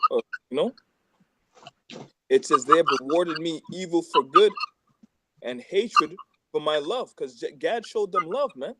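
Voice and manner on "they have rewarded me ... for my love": louder, deliberate psalm reading; scripture reading. Acted. Reading Psalm 109 aloud to friends, in a proclaiming voice. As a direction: proclaim the verse to the group. Repeats verse emphatically.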